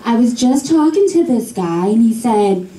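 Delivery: usual speaking voice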